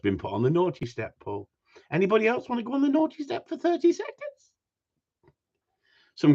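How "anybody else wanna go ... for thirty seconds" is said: high, playful teasing voice. Real, not acted. Host teasing his live chat, playful and much higher. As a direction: taunt the viewers, much higher.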